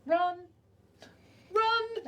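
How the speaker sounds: high urgent call to run; urgent shout. Acted. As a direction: yell at the party to run. Urging party to flee rising water.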